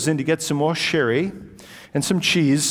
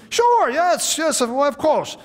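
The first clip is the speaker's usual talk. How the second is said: put-on much higher, eager old gentleman's voice; 11 semitones higher, slower than usual. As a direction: gush out a delighted yes like a genial old host. Old host agrees eagerly.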